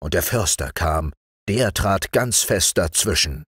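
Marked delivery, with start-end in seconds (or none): none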